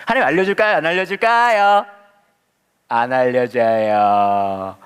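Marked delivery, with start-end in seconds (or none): 2.9-4.8 slow, deliberate, savouring the letdown; drawn-out punchline. Real, not acted. Preacher teasing the congregation, slowly drawn out. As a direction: stretch 안 알려줘요 very slowly, teasing.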